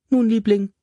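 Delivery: high, honeyed "Liebling"; sweetest smile. A narrator's reading. Mother answering her son with her sweetest smile. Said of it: "in ihrem liebreizendsten Lächeln".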